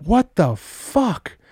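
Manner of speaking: slow, high baffled exclamation; incredulous. Retold or imitated. Hearing the coworker curse, slow stunned disbelief. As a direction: drag it out in disbelief. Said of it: "And I'm like".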